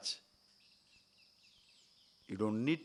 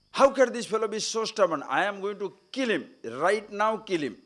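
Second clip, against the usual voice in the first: higher and louder, fuming at the defiance; enraged ruler; 7 semitones higher, 4 dB louder than usual. Retold or imitated. Captor raging at the stubborn prisoner, higher furious voice. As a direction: spit it out like an offended king ready to kill.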